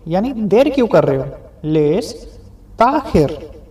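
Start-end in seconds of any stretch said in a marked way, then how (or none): 1.6-3.6 "लेस ताखिर" said slowly, syllable by syllable; slow Arabic phrase. Shown as a demonstration. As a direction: drag out "लेस ताखिर" for the learner. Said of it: "इसे Arabic में बुलाते हैं लेस ताखिर". ("लेस ताखिर" को धीरे धीरे बोलिए)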